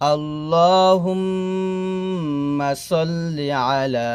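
drawn-out chanted Arabic; measured, sustained teaching recitation; slow recitation. Acted. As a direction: chant slowly, humming the doubled m.

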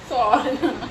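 sorry said through a laugh; laughing apology. Real, not acted. Mother apologising to her son while laughing. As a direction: giggle the apology.

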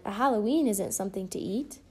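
usual speaking voice

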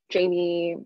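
ordinary talk, nothing marked

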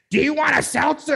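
high, loud, wide-eyed offer; imitating Tommy's high, loud seltzer offer. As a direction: pitch it way up, bug-eyed. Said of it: "he just fucking stays like".

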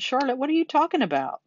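high-pitched, incredulous outburst; alarmed cry. A narrator's reading. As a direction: cry out, demanding an explanation. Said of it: "he cried".

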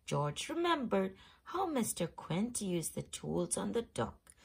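plain reading voice

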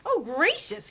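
high, loud astonished gasp; Dorothy exclaims in wonder; a little girl cries out in surprise; surprised cry. A narrator's reading. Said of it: "cried Dorothy".

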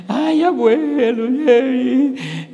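high, loud mocking funeral wail; mock wailing mourner. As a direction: ham up a crying relative at the coffin.

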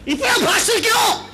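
mock loud question. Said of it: "दूसरा प्रश्न है उनका".

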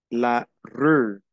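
la rue with a rounded French ü, rü. Shown as a demonstration. Teacher modelling the French vowel: la rue with rounded lips. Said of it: "dos palabras que son casi igual".

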